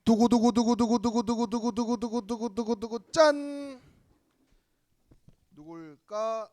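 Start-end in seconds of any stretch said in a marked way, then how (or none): 0.0-3.8 drumroll. Acted. Youth service bulletin draw.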